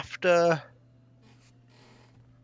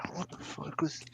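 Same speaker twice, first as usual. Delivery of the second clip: whispered confusion; 4 semitones higher, 11 dB quieter than usual. Retold or imitated. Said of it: "You're like".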